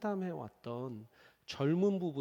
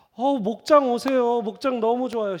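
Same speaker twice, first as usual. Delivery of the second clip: eager invitation; 7 semitones higher, 10 dB louder than usual. Retold or imitated. Inviting to small group.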